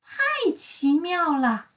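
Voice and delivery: high, slow marvelling cry; amazed exclamation. Acted. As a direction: draw out the wonder. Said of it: "阳光小姐大叫起来".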